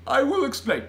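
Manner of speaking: loud, high giant voice; emphatic giant promise; BFG. A narrator's reading. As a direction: declare it grandly. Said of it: "said the B F G".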